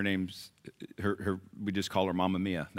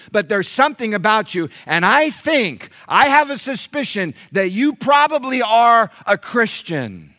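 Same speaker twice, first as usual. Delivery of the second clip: loud, higher-pitched preaching with driven questions; full-force preaching; 12 semitones higher, 11 dB louder than usual. Acted. Pastor challenging church about being known as Christians, forceful preaching. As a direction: preach it hard to the congregation.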